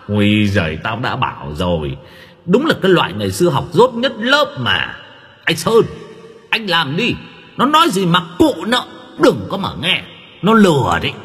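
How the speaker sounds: sly mocking voice. A narrator's reading. Possessed voice mocking and deceiving.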